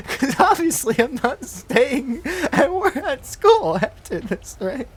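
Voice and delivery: high, loud, laughing through the words. Real, not acted. Teller cracking up at his own school mishap, laughing. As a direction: squeal it out through real laughter.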